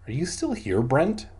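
ordinary delivery

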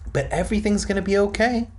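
high, quick mom interjection; chiming in. A narrator's reading. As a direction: chime in fast and high-pitched. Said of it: "Mom chimed in".

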